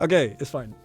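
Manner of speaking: usual speaking voice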